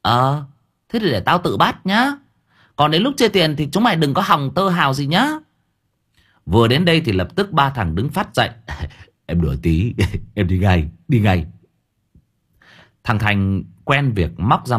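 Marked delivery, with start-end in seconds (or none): 0.0-5.3 higher, stern warning; glaring threat. Acted. Thơm glaring and threatening her brothers. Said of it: "Cô Thơm trợn mắt". 9.3-11.5 laughing nervously, hurry off; mock-sheepish retreat; sheepish backing down. Brothers laughing off the joke to their sister.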